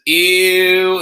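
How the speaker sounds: long, high, loud imitated disgust; Crystal's mock "ew". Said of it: "Crystal says".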